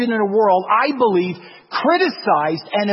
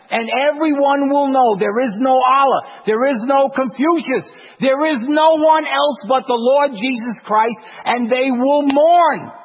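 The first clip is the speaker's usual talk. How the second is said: preaching; 4 semitones higher, 3 dB louder than usual. Real, not acted. Preaching at full force.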